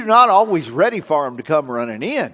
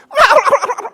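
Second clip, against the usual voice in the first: high loud imitated gobble; high loud turkey gobble; turkey gobble imitation; 9 dB louder than usual. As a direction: gobble back like a tame turkey.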